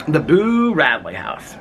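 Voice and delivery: high, drawn-out BOO, falling away; playful high-pitched mock announcement; neighbor's catchphrase. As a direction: stretch out Boo like a theatrical announcement. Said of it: "what my neighbor across the street, she always says".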